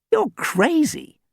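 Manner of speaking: high, slow mock disgust; teasing disdain. Sam scoffing at Dan, high slow mock disdain. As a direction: scoff at him. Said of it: "wrinkling her nose".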